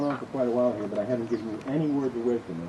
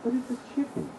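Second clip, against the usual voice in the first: protesting wife; 13 semitones higher than usual. Acted. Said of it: "she protested".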